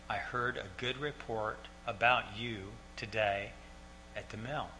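hushed, lingering quote; quiet father. Retold or imitated. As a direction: repeat his words softly, letting each one settle. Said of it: "he was a very quiet man".

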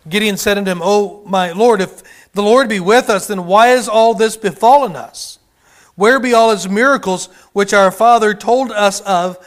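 loud preached Bible reading; declaiming old King James lines; scripture reading. Acted. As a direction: proclaim the verse to the hall. Reads scripture aloud.

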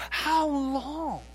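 high anguished cry, held back in volume; with despondency. Shown as a demonstration. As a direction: ache through the question. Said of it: "read it with passion, with despondency".

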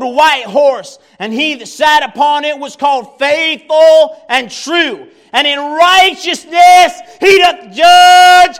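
loud, fervent revival preaching; impassioned sermon delivery; preaching at full force. Real, not acted. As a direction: preach it to the rafters, building to shouts. Preacher reading and preaching Revelation.

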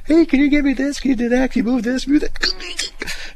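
high mock wife voice; quick string of high requests; mimicking wife. Voicing his wife's requests.

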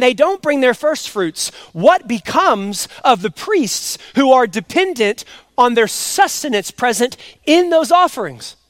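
loud, driving preacher voice; preaching. Acted. Pastor preaching to his church, loud and driving. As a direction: preach it out to the congregation.

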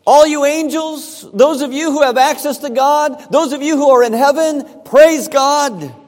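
loud, high call to the angels; psalmist's loud command. Acted. Preacher voicing the psalmist commanding angels, loud and high. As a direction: proclaim it like a herald summoning heaven. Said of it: "He says".

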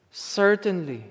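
soft promise. Shown as a demonstration. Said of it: "Not maybe or perhaps, but certainly".

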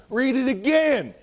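high, loud and slow command; preaching shout. Real, not acted. Preacher telling readers to start over, high and slow. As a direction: draw "again" out like a revival preacher.

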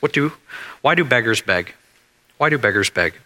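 ordinary talk, nothing marked

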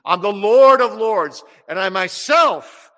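slow booming proclamation; proclaiming Lord. Acted. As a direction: thunder it slowly. Voicing Jesus, building proclamation.

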